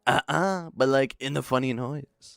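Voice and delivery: exaggerated politician impression; Donald Trump. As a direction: protest like an indignant politician. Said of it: "what you're saying in a Trump voice".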